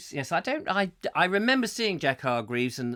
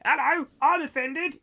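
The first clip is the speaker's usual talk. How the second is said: high, put-on indignant caller voice; exaggerated high-pitched umbrage; offended complainer; 12 semitones higher, 4 dB louder than usual. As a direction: pipe up as a mock complainer. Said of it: "There'll be somebody who's offended".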